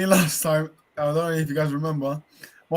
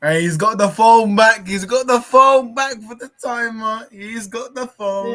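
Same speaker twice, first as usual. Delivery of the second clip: raised, slow excited call; 8 semitones higher, 9 dB louder, slower than usual. Real, not acted. Friend spotting the phone, calling out excitedly. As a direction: call it out, thrilled.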